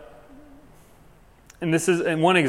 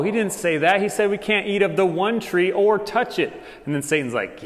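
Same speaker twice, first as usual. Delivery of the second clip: ordinary talk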